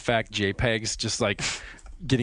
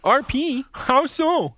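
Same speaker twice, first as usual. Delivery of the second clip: very high, loud put-on voice; squeaky hammed-up chat reading; game master role; 13 semitones higher, 5 dB louder, much slower than usual. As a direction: play the game master in mock falsetto. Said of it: "I could be the G M".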